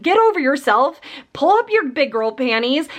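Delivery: ordinary delivery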